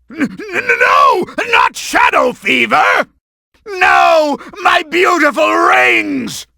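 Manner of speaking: stammering villain yelling in dismay; dismayed wail. Acted. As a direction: wail over the lost treasure. Boss losing his rings.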